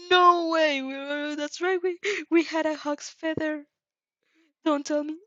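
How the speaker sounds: real shock breaking into the narration; dismayed disbelief. Real, not acted. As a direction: blurt it out in disbelief. Reader realises feather is lost.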